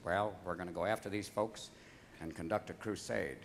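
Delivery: low, quiet presidential impression; George Bush. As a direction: deliver it low and soft, unprepared. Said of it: "He said".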